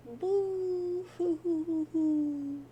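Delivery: loud, slow drawn-out boo hoo wail; exaggerated mock sob; hammed-up sobbing. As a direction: wail it like an overdone cartoon cry. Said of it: "And Jade says, "Sob".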